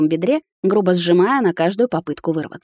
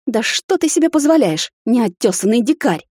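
high, indignant scolding that slows on the insult; outraged protest; 6 semitones higher than usual. A narrator's reading. Woman scolding the hunter carrying her off, outraged. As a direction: berate him in outrage.

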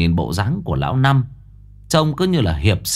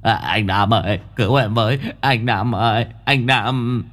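whimpering. Acted. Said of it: "nó cũng mếu máo".

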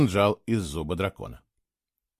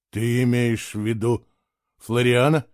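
plain reading voice

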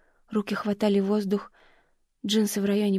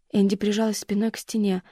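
plain reading voice

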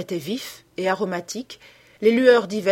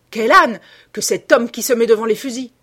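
loud, pitched-up exasperation; angry outburst; 4 semitones higher, 9 dB louder than usual. A narrator's reading. Hunter blaming the man he shot, angry outburst. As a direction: burst out at the fool in your way.

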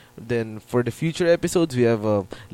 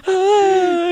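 swooning hi; 4 dB louder than usual. Pretend. Swooning over his celebrity crush.

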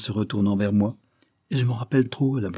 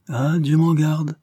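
slow, higher-pitched courtly protest; exquisite politeness; 7 semitones higher, 5 dB louder, slower than usual. A narrator's reading. Invalid lord reassures his visitor Wilson, exquisitely polite. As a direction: draw it out with gracious courtesy. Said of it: "d'un ton d'exquise politesse".

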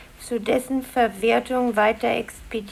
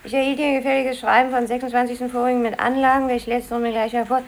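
rapid reading aloud; much faster than usual. Acted. Secretary reading letter aloud.

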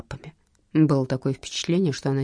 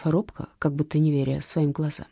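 ordinary delivery

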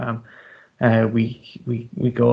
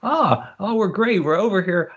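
high, over-keen friendliness; pitched-up, ingratiating cheer; retold eager reply; 7 semitones higher than usual. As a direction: play up the chirpy tourist. Eagerly answering Kevin at bar.

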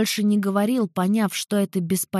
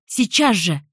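a sudden loud command; commanding shout; 4 dB louder, slower than usual. Acted. Heroine orders everyone to stop, loud command. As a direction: snap the order out loud and sharp. Sharp command ends scene.

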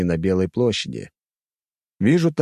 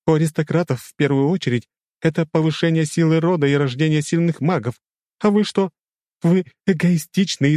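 high, heated scolding rant; lightly raised, indignant female character voice; flaring up; 9 semitones higher, 3 dB louder than usual. A narrator's reading. Tanya scolds spoiled aristocrats, flaring up in open temper. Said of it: "откровенно вспылила".

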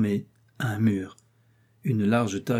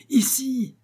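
high ringing shout; crying out. Acted. Riri calls again to the rescuers, high shout. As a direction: call out again, high and strong. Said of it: "cria Riri".

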